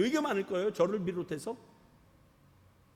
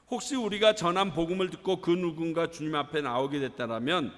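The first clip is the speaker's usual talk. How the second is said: ordinary delivery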